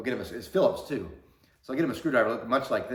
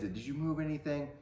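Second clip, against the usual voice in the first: retold yell; 6 semitones higher, 4 dB quieter than usual. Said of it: "So he was yelling at us kids".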